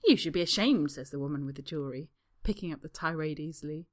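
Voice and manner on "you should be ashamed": scolding. Acted. Woman's tirade.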